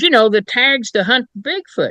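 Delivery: high, eager caller's voice; earnest Bigfoot hunter. Retold or imitated. Bigfoot hunter asking the investigator for tags, high and eager. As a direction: play the hopeful hunter asking innocently.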